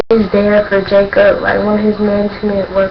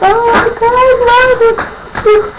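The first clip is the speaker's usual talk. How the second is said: fangirl gushing; 14 semitones higher than usual. Retold or imitated. Fan reacting to Miles on stage, squealing adoringly. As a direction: squeal over a crush. Retold squeal over cute performer.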